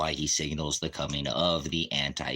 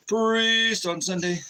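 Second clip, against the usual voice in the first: announcing; 6 dB louder, slower than usual. Real, not acted. Setting up a joke.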